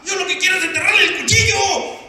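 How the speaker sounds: high, loud, fast outburst; acted fury. Betrayed husband venting to his pastor about his wife, furious. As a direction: vent it in a rushed shout.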